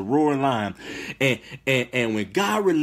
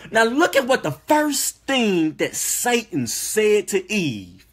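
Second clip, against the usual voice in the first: fired-up preaching; 6 semitones higher than usual. Acted. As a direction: preach it with rising fire. Preaching at higher pitch and force.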